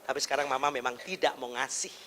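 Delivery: put-on low, soft reasoning with a child; gentle mother. Shown as a demonstration. Mother explains to child why no snack money, calm and soft. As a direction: explain it quietly and patiently. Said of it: "Bilang aja".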